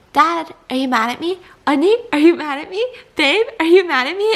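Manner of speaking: building anxious pleading round the room; anxious repeated question. Retold or imitated. Retelling asking dad, sister, fiance, rising anxiety.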